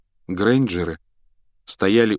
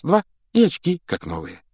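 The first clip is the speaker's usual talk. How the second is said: ordinary delivery, nothing marked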